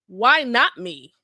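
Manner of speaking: usual speaking voice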